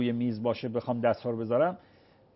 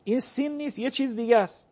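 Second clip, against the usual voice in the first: higher, lightly voiced listener noticing the letter; a touch of a surprised listener; imagined listener's remark; 8 semitones higher than usual. Cleric voicing a listener who hears it isn't sin, lightly. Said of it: "شنونده متوجه بشه که بابا".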